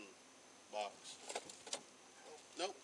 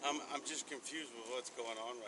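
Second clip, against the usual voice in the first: slurred speech; 9 semitones lower than usual. Real, not acted. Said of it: "You're slurring, you're slurring your words".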